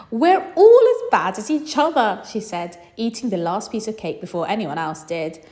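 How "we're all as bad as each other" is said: loud, high amused admission; laughing at herself; laughing mum. Acted.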